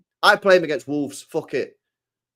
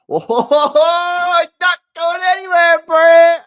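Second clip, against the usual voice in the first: much higher and louder, a gleeful yelp; thrilled squeal; 11 dB louder, much slower than usual. Real, not acted. Streamer squealing to chat that Stones is staying, overjoyed. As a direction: shriek for joy at the good news.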